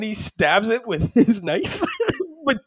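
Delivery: high, slow words through real laughter; laughing while talking. Real, not acted. Telling co-host about the knife stunt, laughing while talking. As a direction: crack up mid-sentence. Cracking up while retelling stabbing.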